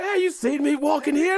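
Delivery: high falsetto outrage without real volume; hammed-up high-pitched protest; mock indignant yell. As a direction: imitate yelling at a driver, squeaky and indignant. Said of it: "the nerve to yell at the car".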